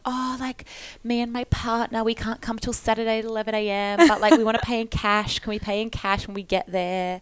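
a hint of a sweet-talking scammer; mock buyer voice. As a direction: play an eager fake fridge buyer. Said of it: "I was like".

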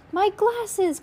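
high, loud cry of dismay; boy crying out in distress; anguished cry. A narrator's reading. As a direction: cry out in despair over the glasses. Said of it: "Klaus cried".

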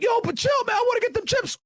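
hammed-up protest at full pitch; yelling about the chips in a put-on voice; mock shouting Zokar. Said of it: "Zokar's like".

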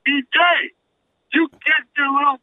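high, put-on scolding holler; mock angry parent. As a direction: summon the kid like a furious mother. Parent yelling at child, mocked.

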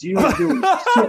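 high, slow, laughing through the line; laughing squeak. Retold or imitated. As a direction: crack up mid-accusation. Laughing mock accusation.

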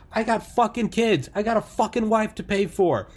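high, furious yelling; angry shop owner. Retold or imitated. Shop owner yelling at protesters, furious. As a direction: yell it like a man whose store was wrecked. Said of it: "He goes".

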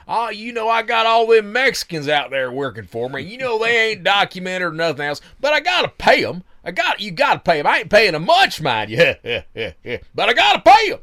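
hammed-up Southern good old boy; thick Southern accent. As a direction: play a Southern construction boss, loud and folksy. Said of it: "this thick Southern accent of".

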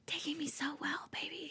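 breathy husky whisper. Shown as a demonstration. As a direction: whisper it huskily, close. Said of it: "he whispered huskily".

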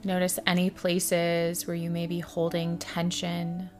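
soft, hushed meditation guide with long pauses; guided meditation. Acted. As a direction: ease the listener into stillness, softly and unhurried.

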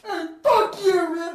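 high, loud, drawn-out yell of rage; furious yell. Retold or imitated. Player losing it on the field, loud slow yell. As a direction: scream it at him like a maniac. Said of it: "goes out in the field and goes".

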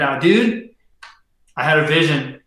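usual speaking voice

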